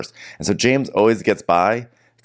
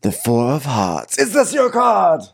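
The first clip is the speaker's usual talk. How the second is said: mock magician reveal; 8 semitones higher than usual. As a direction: unveil the card with flourish. [name] doing a card trick.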